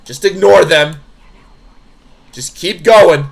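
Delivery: loud, pressing urging; urgent command. Acted. Character urging companion past pursuers, urgent command. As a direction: push them onward loudly.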